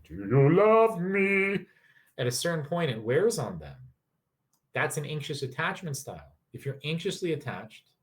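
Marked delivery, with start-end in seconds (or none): 0.0-1.7 high, very slow, loud drawn-out whine; milk the neediness; needy whine. Pretend. Anxious partner begging reassurance, drawn-out whine.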